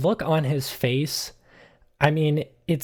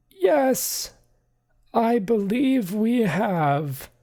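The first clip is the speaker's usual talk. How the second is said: high, slow stiff butler voice; a prim, put-upon manservant; slow and high, stiffly formal; bewildered butler Archimedes; 11 semitones higher, much slower than usual. Acted.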